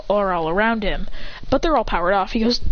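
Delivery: plain reading voice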